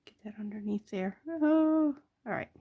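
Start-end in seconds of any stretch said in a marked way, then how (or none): 0.0-1.2 soft unvoiced whisper to herself. Real, not acted. Whispering to the tiny rhinestone as she places it. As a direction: breathe the words while placing the stone.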